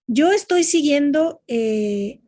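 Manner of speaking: ordinary talk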